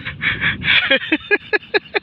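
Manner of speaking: high, voiceless giggling; long wheezy laugh in high bursts; amused laugh. Real, not acted. Adult amused by child's onion cry, high laughing.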